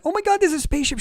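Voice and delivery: very high, astonished exclamation; imitated shock. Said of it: "she's actually shocked".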